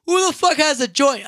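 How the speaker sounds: loud, high call to the group; yelled-out demand; retold shout. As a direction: holler it out over a crowd. Calling out to a rowdy group.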